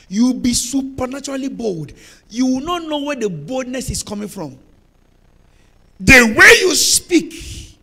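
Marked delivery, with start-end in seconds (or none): 6.0-7.8 slow, loud and drawn out; dramatic pulpit delivery; emphatic preaching. Acted. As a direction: stretch each phrase for weight.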